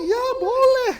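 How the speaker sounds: high, light voice for the mother; mother's reply. Acted. Preacher voicing Betty's mother answering her, teasing and high. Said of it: "mamanya mengatakan".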